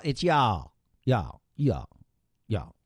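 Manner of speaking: "y'all" said as a single drawled syllable, yawl, in a Southern accent; trying out a Southern "y'all" on the air. Shown as a demonstration. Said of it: "It's not you all".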